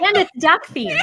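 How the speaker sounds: loud, high and excited; excited shout. Real, not acted. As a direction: call it out with delight. Excited reaction to the joke.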